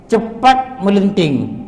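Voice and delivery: "Cepat melenting" drawn out slowly, word by word; emphatic term. Shown as a demonstration. Teacher shows students the phrase for temper, "Cepat melenting" said slowly. Spells out the term just introduced.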